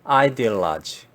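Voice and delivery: ideology slowly in English, stress on AH; ideology drawn out slowly, English sounds; English pronunciation. Shown as a demonstration. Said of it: "영어 발음식으로 해보겠습니다". (영어 발음으로 천천히 ideology)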